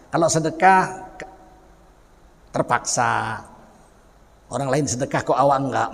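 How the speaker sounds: normal talking voice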